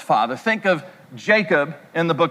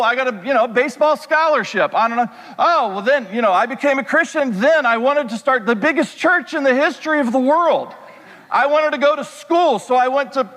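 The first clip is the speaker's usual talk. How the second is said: higher, rushing list of achievements; worked-up reply; 4 semitones higher than usual. Retold or imitated. Son firing back at his dad, higher and worked up. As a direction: pour out every accomplishment to prove it. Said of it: "And I would say".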